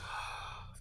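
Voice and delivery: soft, airy sigh of giving in; resigned sigh. Acted. Izuku giving in to Aizawa's begging, resigned sigh. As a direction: sigh before reluctantly agreeing.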